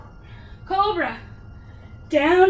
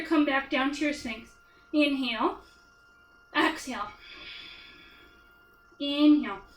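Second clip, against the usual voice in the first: lower, softer instructor voice easing the class down; calm cool-down cueing; 4 semitones lower, 4 dB quieter than usual. Acted. Fitness instructor guiding floor stretches after cardio, lower and softer. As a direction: settle everyone's heart rate gently.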